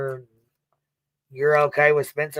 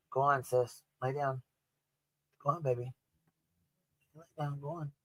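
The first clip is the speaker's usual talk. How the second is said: much softer, gentle go on, lay down; soft coaxing; 12 dB quieter than usual. Real, not acted. Soft coaxing to the one lying beside him. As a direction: ease someone down to rest, barely voiced.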